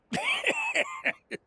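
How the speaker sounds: high-pitched laugh; chuckle. Acted. Part maker told it looks like a normal part, high chuckle. Reaction to the plain-looking part.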